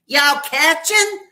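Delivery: very high, slow, loud squeak; loud squeaky voice. Retold or imitated. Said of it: "he has a loud, squeaky voice".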